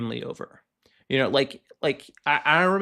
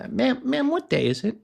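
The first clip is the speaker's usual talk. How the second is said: mock patient agent; 7 semitones higher than usual. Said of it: "I'm like".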